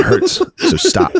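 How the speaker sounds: high, laughing mock whine; laughing mock hurt. Joking as the teased boy, high laughing whine. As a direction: squeal it through laughter like a wounded child.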